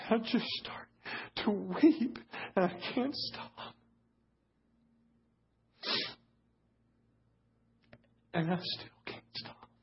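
high, choked voice giving out into long silences; breaking into tears. Real, not acted. Preacher reliving weeping during pastoral prayer, voice breaking. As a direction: struggle to speak through real tears.

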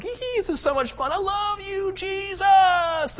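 very high, giddy squeal; hammed-up high-pitched devotion; mock zealous passion. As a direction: gush like an overexcited worshipper, drawing out the end. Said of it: "get all zealous and passionate for God".

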